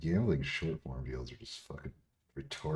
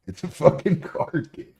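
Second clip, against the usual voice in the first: much higher, louder, voice straining with disbelief; incredulous outburst; 12 semitones higher, 8 dB louder than usual. Real, not acted. Streamer mocks a critic who plays blackjack, high incredulous squeal.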